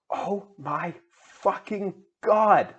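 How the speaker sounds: hammed-up drawn-out fangirl cry; screamed. A narrator's reading. Ebony hears about the Good Charlotte concert, mock scream. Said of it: "I screamed".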